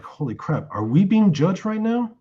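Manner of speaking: mock panic, pitch jumping high on the question; retold freaking out. Huddled group asking each other in alarm, acted-out freak-out. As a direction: imitate a group starting to freak out. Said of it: "freaking out".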